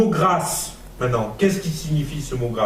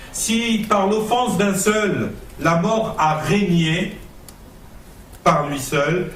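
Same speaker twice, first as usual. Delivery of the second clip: scripture reading; 6 semitones higher, slower than usual. Acted. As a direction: proclaim the verse slowly. Reads scripture aloud.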